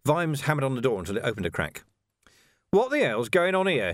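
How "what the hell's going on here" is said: high-pitched, indignant demand; outraged, higher voice; angry demand. A narrator's reading. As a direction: demand an explanation, pitch jumping up. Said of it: "he demanded".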